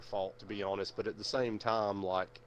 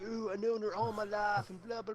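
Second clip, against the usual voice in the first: high, hammed-up dopey voice; mock dreamy boast; mocking the guy; 10 semitones higher than usual. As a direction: imitate his lame excuse mockingly. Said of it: "He's like".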